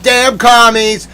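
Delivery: slow, loud, high curse; mad outburst. Real, not acted. Cursing the commies mid-rant, mad. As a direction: yell it drawn out in exasperation. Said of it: "I get so mad".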